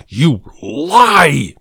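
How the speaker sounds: the jealous husband bellowing at the salesman; angry bellow. A narrator's reading. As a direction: throw the accusation at him. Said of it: "he bellowed".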